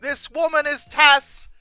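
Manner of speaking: normal talking voice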